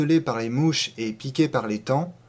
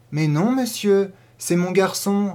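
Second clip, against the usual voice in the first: higher, emphatic proud reply; maternal pride; 6 semitones higher, 4 dB louder than usual. A narrator's reading. Mother correcting a gentleman about her child, proud. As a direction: lift it high with a mother's pride. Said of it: "avec un orgueil maternel".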